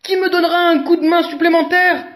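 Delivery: high, loud and fast appeal; urgent high appeal; elephant asking. A narrator's reading. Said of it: "demande Éléphant".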